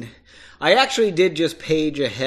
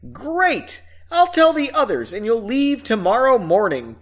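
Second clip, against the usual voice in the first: delighted; 8 semitones higher, 4 dB louder than usual. Acted. Stephen delighted at agreement.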